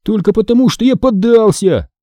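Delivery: high, protesting comeback; objecting. A narrator's reading. Brother disputing his sister's win, objecting. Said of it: "возразил Дэниел".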